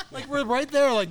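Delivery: words through high laughter; laughing. Real, not acted. Laughing while telling.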